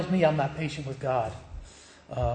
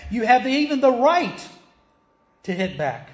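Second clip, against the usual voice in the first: higher and louder sermon climax; preaching at full force; 3 semitones higher, 4 dB louder than usual. Acted. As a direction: drive it home from the pulpit.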